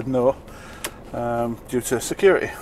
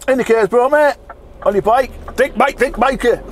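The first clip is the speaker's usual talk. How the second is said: sarcastic yell at driver; 8 semitones higher than usual. Real, not acted. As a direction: jeer at the driver who won't indicate. Yelling at driver without indicators.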